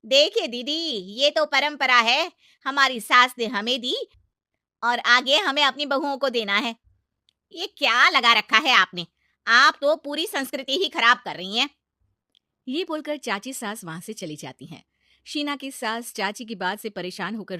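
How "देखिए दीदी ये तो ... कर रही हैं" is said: loud, angry lecture on tradition; aunt angrily defending tradition; loud berating. Acted. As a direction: berate her loudly.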